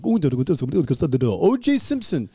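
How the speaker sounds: mock Spanish TV gibberish, landing on a drawn-out name. Comic retelling a Spanish channel bit, mock Spanish. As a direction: imitate a Spanish channel presenter. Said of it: "speak Spanish gibberish".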